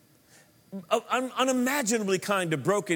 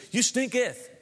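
put-on stinketh said with a grand old-English flourish; mock Elizabethan English; 6 semitones higher than usual. Preacher joking how Jesus said it in Elizabethan English, mock archaic. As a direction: ham up the King James stinketh. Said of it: "Elizabethan English".

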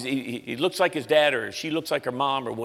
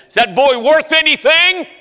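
much higher, louder, slow dismissive drawl; gruff old rigger; 13 semitones higher, 11 dB louder than usual. Retold or imitated. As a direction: talk past the boy to his father. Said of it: "He said".

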